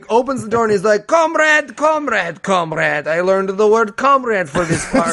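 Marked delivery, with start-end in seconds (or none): 1.1-5.1 put-on comically thick Russian accent; mock a bad actor's Russian accent; overdone Russian accent. Drunk communist at the door, hammy Russian accent. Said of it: "this sounds way too Russian".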